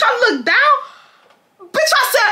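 excited laughing. Real, not acted.

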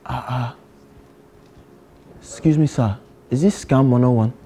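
put-on lightly held Nigerian accent, earnest student; a Nigerian student pleading with the teacher. To a geography teacher, a mild Nigerian accent. Persona at math class.

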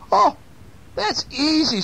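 high little mouse voice; high-pitched storybook mouse; confident mouse. A narrator's reading. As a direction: answer brightly as a tiny mouse. Mouse answers weasel in story.

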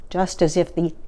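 usual speaking voice

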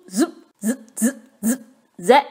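usual speaking voice

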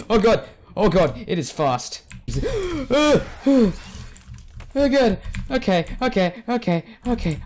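high, loud panicked cries; real panic, yelp it out; freaking out. Real, not acted. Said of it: "I'm freaking out".

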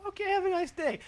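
cheery high clerk voice; high mock cashier voice. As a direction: toss it off like a bored clerk. Cashier's reply quoted in story.

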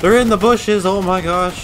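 high, loud real alarm; startled high-pitched yell; alarmed callout. Real, not acted. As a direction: shout a sudden warning to the squad. Sudden enemy sighting in game.

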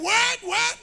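high, loud amazed exclaim; mock awed listener. Congregation wowed by the preacher, mock excited. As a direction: imitate a crowd dazzled by talk.

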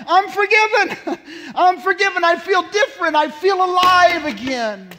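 high, loud joyful cries; gasped elation. Retold or imitated. Revived sinner realizing they're forgiven, elated gasping shouts. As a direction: burst out as if shocked back to life. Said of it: "you'll sometimes hear them gasp out".

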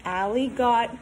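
usual speaking voice

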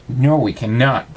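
ordinary delivery, nothing marked

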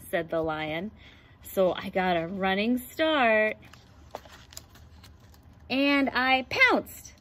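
put-on lively picture book read-aloud for kids; reading to children. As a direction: read it like storytime for the class. Reads picture book to class.

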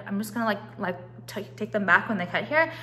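usual speaking voice

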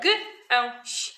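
"G, e, ś" as three short separate sounds; sounded out. Shown as a demonstration. Teacher recapping for preschoolers, "G, e, ś" each sound separate. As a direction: tick off "G, e, ś" sound by sound. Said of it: "Tylko trzy głoski".

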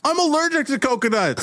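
high-pitched plaintive objection; hammed-up whine from the crowd; whiny crew member. Crew member whines about coconuts.

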